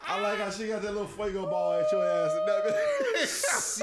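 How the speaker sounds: loud laughter breaking the words; laughing loudly. Real, not acted. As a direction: crack up while getting the words out. Cracking up at the punchline.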